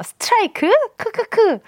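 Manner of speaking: "스트라이크" pitched high as a doubting question, then a spelt-out snicker; 물음표 살려 읽기. Retold or imitated. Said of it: "스트라이크 물음표하고 크크크 하셨거든요". ("스트라이크?"를 높게 되묻고 "크크크")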